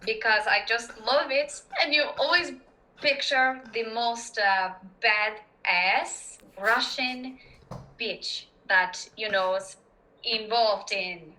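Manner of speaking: slowed-down Russian accent with halting pauses. Shown as a demonstration. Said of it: "get inside of a Russian accent".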